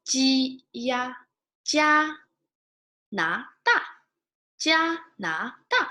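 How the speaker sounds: Ji plus ya blended into jia, then na, da, each clearly separated; teacher spelling a word in pinyin. Shown as a demonstration. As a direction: build jia from ji and ya, then add na, da. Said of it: "Spell with the initials and the vowels".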